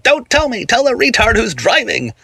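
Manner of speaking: high-pitched exasperated shout; angry shout. A narrator's reading. Coworker telling off his nagging watch, high angry shout. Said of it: "I shouted at it".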